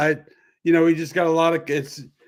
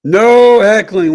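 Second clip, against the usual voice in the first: emphatic no; 6 semitones higher, 10 dB louder, slower than usual. Real, not acted. Jokingly forbids heckling.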